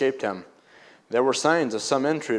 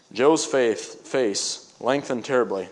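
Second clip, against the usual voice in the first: ordinary delivery, nothing marked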